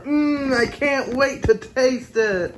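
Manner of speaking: high, slow, savoring each word; drawn-out yummy mmm, sweetly eager; eager and hungry. Pretend. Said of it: "Say it like you did before".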